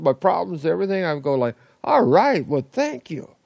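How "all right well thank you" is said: loud, high burst of delighted thanks; spontaneous gratitude. Pretend. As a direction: react as if suddenly handed a big gift. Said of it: "It would just be spontaneous".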